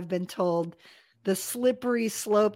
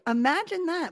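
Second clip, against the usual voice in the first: amazed; 7 semitones higher than usual. Real, not acted. Marvels at being given a life.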